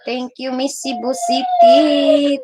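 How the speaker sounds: a gushing singsong thank-you to a fan; playful drawn-out thanks. Pretend. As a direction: sing the thanks out high and slow. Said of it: "exaggerated drawn-out thanks to viewer".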